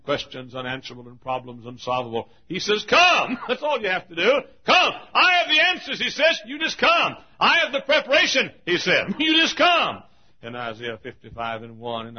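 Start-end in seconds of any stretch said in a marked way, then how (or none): 2.5-10.0 very high, loud pulpit shouting; fervent revival climax; full-force preaching. Acted. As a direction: hammer out the invitation.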